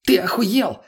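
high-pitched, incredulous anger; indignant explosion. A narrator's reading. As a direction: blow up in outrage, pitched high. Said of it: "Тут же взорвался тот".

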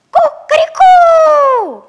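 very high, loud, slow crowing; rooster crow. Acted. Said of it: "закричал".

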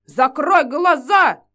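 loud slow yelled command; shouting. Acted. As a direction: bellow глаза at her. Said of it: "тут же проорала бабка".

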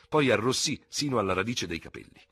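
usual speaking voice

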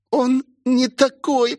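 высоко и медленно, через силу; прокряхти высоким голосом, поднимаясь; кряхтение. A narrator's reading. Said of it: "прокряхтела Роузи". (кряхтя от натуги)

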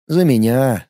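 ordinary delivery, nothing marked

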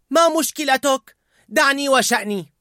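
very high, loud yell; angry shout. A narrator's reading. Riley shouting at her father, angry. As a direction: yell it at him. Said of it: "صاحت رايلي".

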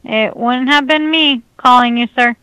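ordinary talk

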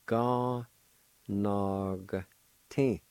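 "Ga, nog, tin" in long separate syllables, G joined onto nog; syllable-by-syllable demo. Shown as a demonstration. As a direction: sound out "Ga, nog, tin" with clear gaps. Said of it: "pronounce the G sound with the N A like this".

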